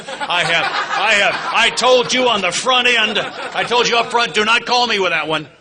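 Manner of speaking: high, loud, laughing through the words; laughing warning. Real, not acted. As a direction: crack up while scolding them playfully. Joking warning to the men.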